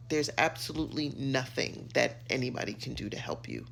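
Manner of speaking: ordinary talk, nothing marked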